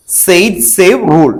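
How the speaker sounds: slow and deliberate; name the rule slowly so they catch it; slow, careful naming. Shown as a demonstration. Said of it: "spelling ഇങ്ങനെ എഴുതണം". (പതുക്കെ വ്യക്തമായി)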